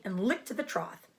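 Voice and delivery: plain reading voice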